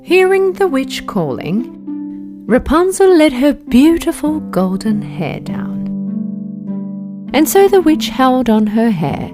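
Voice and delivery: ordinary delivery, nothing marked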